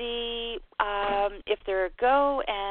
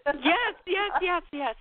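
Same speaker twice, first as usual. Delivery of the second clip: high, crying-out joyful yeses; thrilled yes; 7 semitones higher, slower than usual. Real, not acted. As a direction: burst out with delight. Caller thrilled by lottery reading.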